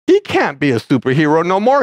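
ordinary delivery

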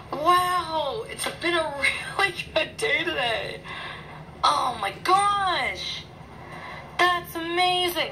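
high-pitched, giddy amazement; real delight, voice lifted high; overjoyed. Real, not acted. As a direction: gush over a lucky win. Overjoyed after getting the wanted card.

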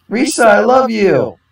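high, slow lovestruck cry; adoring fan shout. Acted. Crowd fan calling out to Risa, high adoring cry. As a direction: call out your love to the star.

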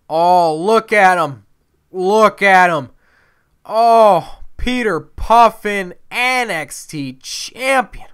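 high, loud, drawn-out fawning; gushing admiration. Pretend. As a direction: swoon noisily over your champion's entrance. Gushing over own wrestler's entrance.

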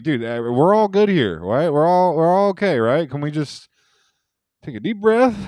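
high, loud plea for calm; retold anxious placating. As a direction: talk someone down with the voice pushed up high. Retold attempt to calm the aunt.

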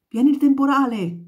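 loud, high cry across the streets; shouted warning. Acted. People in the streets shouting as the storm arrives. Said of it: "si sentiva gridare per le strade".

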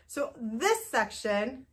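normal talking voice

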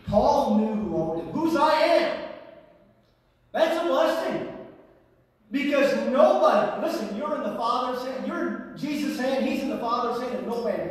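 high, loud, urgent preaching; emphatic preaching. Acted. Preaches with raised force.

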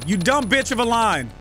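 normal talking voice

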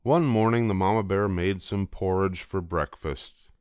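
ordinary delivery

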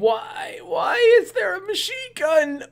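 high, shouted disbelief; incredulous shout. Real, not acted. To the movie screen, shouting in high-pitched disbelief.

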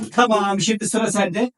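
ordinary talk, nothing marked

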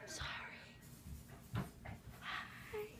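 breathy whisper. Real, not acted. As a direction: whisper it so you're quiet. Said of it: "You're too loud".

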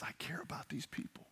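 tender, very soft whisper; hushed whisper. Acted. God telling Jonah of his care, hushed whisper. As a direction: drop to an earnest whisper. God's tender word after the rebuke.

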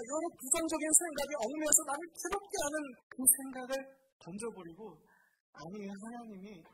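normal talking voice

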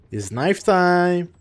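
menacing slow hype. Pretend. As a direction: stretch it out slowly. Hyping knife round.

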